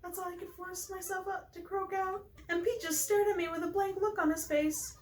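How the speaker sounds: high, self-reproaching character voice; lovestruck Pelly character, embarrassed. Acted. Player reading Pelly's regret over Pete, high and embarrassed.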